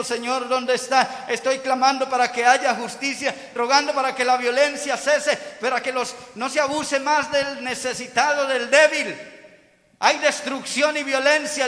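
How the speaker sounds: high, insistent plea; crying out to God. Acted. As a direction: plead urgently for justice before God. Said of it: "delante de Dios clamando y diciendo".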